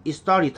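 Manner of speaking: "It started" quickly linked as "istarit"; native-speaker pronunciation. Shown as a demonstration. Teacher repeating the native reading for learners, linked and reduced. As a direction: drop the t's in "It started", flap into "i-starid". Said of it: "원어민들이".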